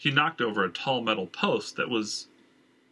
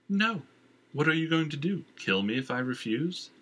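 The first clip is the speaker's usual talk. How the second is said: a light, teasing purr pitched higher; lady purrs her challenge at Havlin; 5 semitones higher than usual. A narrator's reading. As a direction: purr it out, unafraid and taunting. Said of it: "she purred".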